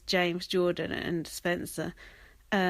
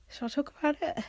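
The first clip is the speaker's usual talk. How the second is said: normal talking voice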